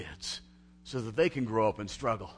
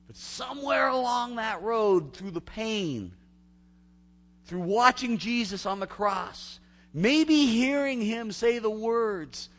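slow impassioned preaching; 9 semitones higher, 6 dB louder, slower than usual. Real, not acted. Slow rising preaching narration.

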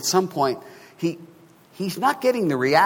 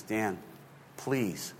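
quiet plea; 9 dB quieter than usual. Retold or imitated. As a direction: softly imitate a wife pleading. Wife pleads before the fight.

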